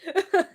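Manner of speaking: burst of laughter. Real, not acted. Laughs at her own example.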